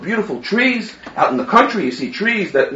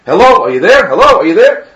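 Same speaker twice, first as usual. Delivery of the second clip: louder, insistent phone check; calling out; 6 dB louder than usual. Acted. Groom to bride on a silent phone line, calling out. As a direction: raise your voice into a silent line.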